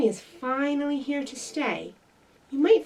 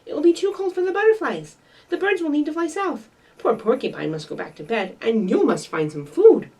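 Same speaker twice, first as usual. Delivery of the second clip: urgent; 5 semitones higher, 6 dB louder, faster than usual. Acted. Fletcher still raising alarm.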